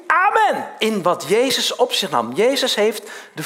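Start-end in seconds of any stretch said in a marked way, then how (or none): 0.1-0.7 high, loud ringing call; calling out amen. Retold or imitated. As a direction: shout the amen as a cry of the people. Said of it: "geabsorbeerd in die roep".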